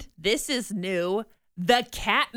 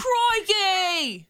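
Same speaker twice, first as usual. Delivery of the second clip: drawn-out high Aussie crikey; Australian accent; 8 semitones higher than usual. Pretend. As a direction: echo the Aussie crikey back. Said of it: "[name] pretending to be Australian".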